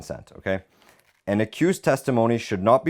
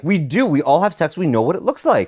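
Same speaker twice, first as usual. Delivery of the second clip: loud, high and fast protest; heated insistence; 9 semitones higher, 5 dB louder than usual. Real, not acted. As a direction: argue back forcefully, voice raised. Heated argument over consent.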